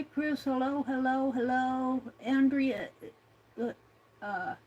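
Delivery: normal talking voice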